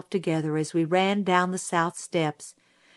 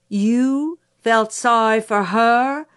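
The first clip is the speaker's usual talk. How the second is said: slow, raised, drawn-out incredulous question; 4 semitones higher, 4 dB louder, slower than usual. Acted. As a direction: question it slowly in disbelief. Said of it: "seemed ready to rise to the ceiling".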